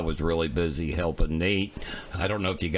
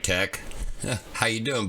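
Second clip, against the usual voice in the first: ordinary talk, nothing marked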